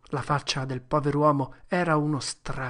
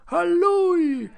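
high, loud shout; crowd shouting; 8 dB louder, much slower than usual. Acted. Crowd spotting Potter, shouting high and loud. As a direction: yell it across the graveyard. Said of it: "E voci gridarono".

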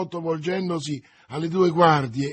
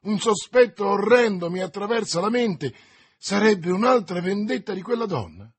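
higher, louder, urgent alarm; alarmed suspicion; 5 semitones higher, 5 dB louder than usual. A narrator's reading. D'Artagnan tells friends his horrible suspicion, alarmed. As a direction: voice a dreadful suspicion with mounting alarm.

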